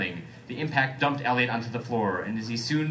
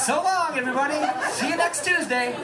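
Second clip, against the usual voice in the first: high, chirpy mock goodbye; breezy farewell; 3 dB louder than usual. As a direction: wave everyone off brightly from the wreck.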